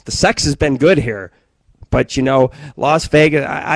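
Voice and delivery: ordinary delivery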